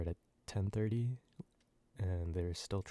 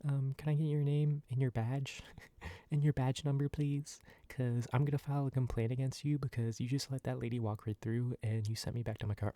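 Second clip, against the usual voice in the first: imitating a higher-pitched complaining customer; complaining guest; 5 semitones higher than usual. Upset guest demanding his badge number, mock complaining voice. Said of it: "and she was like".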